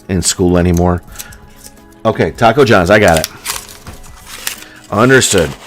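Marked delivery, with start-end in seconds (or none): none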